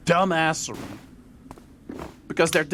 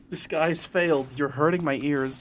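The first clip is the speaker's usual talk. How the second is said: usual speaking voice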